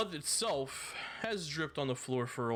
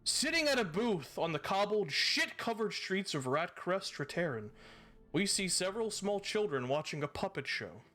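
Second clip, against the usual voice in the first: measured storyteller scene setting; game master narration; 8 semitones higher, 3 dB quieter than usual. A narrator's reading. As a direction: paint the scene for the players.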